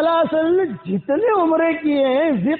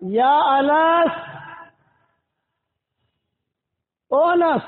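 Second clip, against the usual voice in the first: calling out; 3 semitones higher, much slower than usual. Retold or imitated. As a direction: stretch the name out as if calling from another house. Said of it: "آواز لگائی". (بیٹے کو دور سے کھینچ کر پکارنا)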